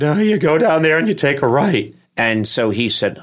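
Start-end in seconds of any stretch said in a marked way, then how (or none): 0.0-1.9 quick, higher, flustered directions; blurting out the wrong way in a panic; panicked answer. Retold or imitated. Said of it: "I panicked and I just said".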